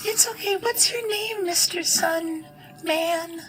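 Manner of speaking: very high, sweet squeaky voice; tiny fairy voice. Acted. Honeysuckle asking the paladin his name, very high and small. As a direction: ask kindly in a tiny fairy voice. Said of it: "she says".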